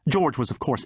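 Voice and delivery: plain reading voice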